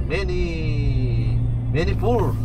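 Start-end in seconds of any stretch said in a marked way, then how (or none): none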